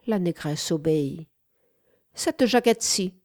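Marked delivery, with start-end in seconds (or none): 2.1-3.1 higher-pitched, curt order; commanding. A narrator's reading. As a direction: order it as the mistress. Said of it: "ordonna encore Wanda". (ton de commandement)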